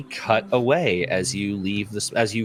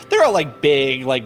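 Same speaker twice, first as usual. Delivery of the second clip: ordinary talk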